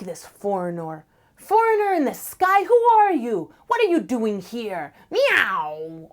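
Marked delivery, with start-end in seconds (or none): 1.3-6.1 high, loud hostile challenge to the sky; angry cat yell. Acted. Ocelot yells at the star.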